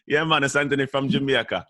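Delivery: put-on goofy Jamaican accent with a laugh; mock Jamaican accent. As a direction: tease in a silly Jamaican accent. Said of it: "I use my stupid Jamaican accent".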